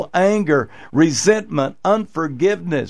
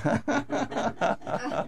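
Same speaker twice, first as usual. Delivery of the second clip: soft laugh; 6 semitones higher, 10 dB quieter than usual. Real, not acted. Laughs at wife's retort.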